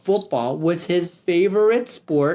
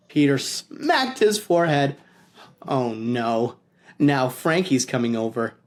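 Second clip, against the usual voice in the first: usual speaking voice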